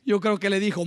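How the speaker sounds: usual speaking voice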